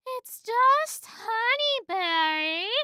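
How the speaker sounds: high, slow put-on Vanessa voice; lightly sing-song mock Vanessa; stretched-out high imitation of Vanessa. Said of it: "uh, Vanessa going".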